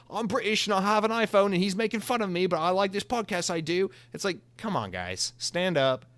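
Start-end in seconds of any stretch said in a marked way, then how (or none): 0.1-3.9 higher, lightly put-on British accent; mock British listener. Host mocking British fans who own iPhones, in mock British accent. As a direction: imitate a fond British fan in a mock British accent. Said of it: "you guys are just like".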